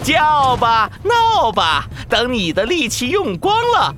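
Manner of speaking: taunting villain. Acted. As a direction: jeer at the raging dinosaur. Villain taunts from behind wall.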